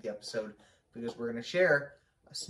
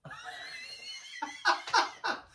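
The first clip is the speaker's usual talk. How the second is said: loud laugh; 3 dB louder than usual. Real, not acted. Bursts out laughing at the idea.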